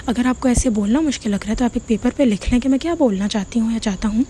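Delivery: ordinary delivery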